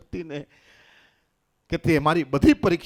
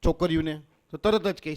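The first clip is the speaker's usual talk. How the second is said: ordinary talk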